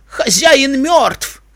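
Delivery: high, long-drawn shout; stretched, high-pitched proclamation; crying out. A narrator's reading. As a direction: call it out slowly over the crowd. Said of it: "крикнул Лок".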